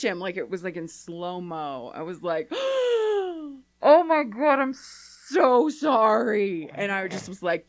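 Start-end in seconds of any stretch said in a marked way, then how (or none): 2.5-3.6 long high mock gasp of horror; shocked gasp. Mother retelling her horror at her kid's pickpocketing, mock gasp. 3.8-6.7 loud, high, acted-out horror, stretching the words slowly; mortified apology. Mother retelling her apology for her pickpocket kid, mortified and loud.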